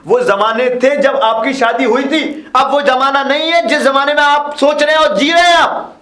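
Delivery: high-pitched, exasperated protest; heated argument. Acted. Grandson telling grandfather times have changed, exasperated. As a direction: push the point with rising force.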